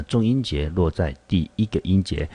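usual speaking voice

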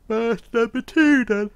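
words stretched out through a big yawn. Real, not acted. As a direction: yawn your way through it, slow. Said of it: "sorry I keep yawning".

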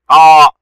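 قا with a loud, drawn-out aa; long alif. Shown as a demonstration. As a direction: stretch the vowel of قا. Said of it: "صوت طويل، مد بالألف".

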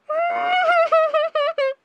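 long high-pitched giggly squeal; high, helpless giggle. Real, not acted. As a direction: let out a drawn-out fangirl squeal. Fangirl reacting to the scene.

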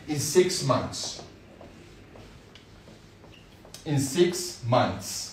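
slow, drawn-out in six months; slow emphatic promise. Shown as a demonstration. Teacher slowly stresses the six-month promise to students. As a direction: stretch six.

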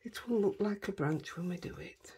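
softly whispered aside, unvoiced. Real, not acted. Crafter to viewers while painting, whispered.

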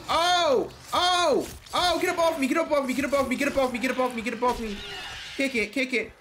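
high, loud frantic yells; panicked screaming. Real, not acted. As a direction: scream for your life. Attacked by monster, screaming.

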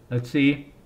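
ordinary delivery

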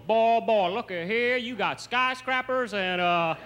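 high-pitched, hammed-up Southern drawl; southern accent. Southerner gawking at New York, exaggerated southern accent. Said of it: "you only wanna talk with a southern accent".

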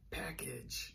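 low unvoiced whisper; hushed pretend word. Shown as a demonstration. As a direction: breathe the word softly. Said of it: "We could pretend to".

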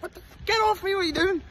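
pitched high, retold shock at being grabbed; startled outburst. Hugged by three lads at the hotel, startled retold outburst. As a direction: yelp it like strangers just jumped you. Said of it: "I was like".

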